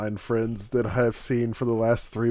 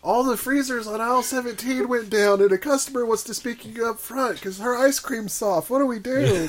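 panicked employee; 8 semitones higher than usual. Acted.

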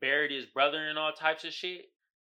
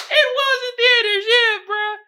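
laughing; 11 dB louder than usual. Real, not acted.

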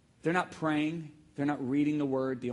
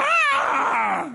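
long, high, loud scream; pained scream; 10 dB louder than usual. Retold or imitated. Climber hurting his knee on a rock wall, pained scream. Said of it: "And I went".

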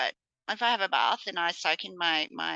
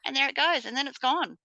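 higher, brighter gone-away voice; cheery narration; 7 semitones higher than usual. Retold or imitated. Telling kids the bathwater germs are leaving, cheery lilt.

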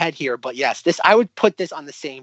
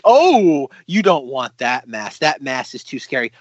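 mock warning; slower than usual. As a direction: open big, then warn slowly like a hammy shopkeeper. Acts warning shopkeeper.

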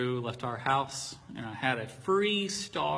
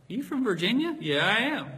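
imitating barista; 8 semitones higher, 4 dB louder than usual. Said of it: "She goes".

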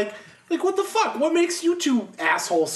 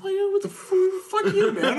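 high, laughing outburst; laughing outrage; 13 semitones higher than usual. Retold or imitated. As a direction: squeal the outrage through a laugh.

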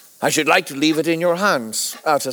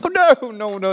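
eager protest; 6 semitones higher than usual. Acted. Jekyll hastily protests to friend.

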